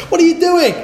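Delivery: high-pitched startled question from a friend; mock alarmed question. Friend questions Rico undressing on the beach, mock alarmed. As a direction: imitate an astonished mate, voice leaping up. Same retold question, restarted after aside.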